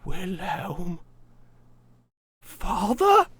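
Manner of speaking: put-on high, slow, tearful old woman voice; an old daughter hearing her father.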